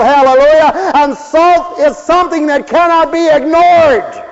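high, loud, slow preacher's cry; fervent preaching. Acted. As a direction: hammer the message home like a revival preacher. Preaching at full force.

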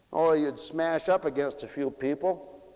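usual speaking voice

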